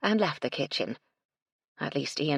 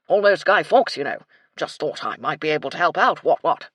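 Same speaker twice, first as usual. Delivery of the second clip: put-on very loud, hearty old officer; blustering colonel; 9 dB louder than usual. A narrator's reading. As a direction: boom it out like a military duffer.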